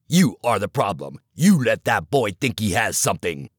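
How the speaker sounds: low, hard coach voice cutting him down; stern older coach. Acted. Coach scolding the assistant in the dugout, low and hard. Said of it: "barely containing his ire".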